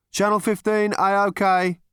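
bright call of all clear; second technician. Acted. Second technician confirming channel, high and loud.

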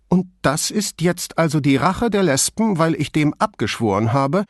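high, biting mock question; sarcastic. A narrator's reading. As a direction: ask it with scornful disbelief. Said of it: "fragte sie sarkastisch".

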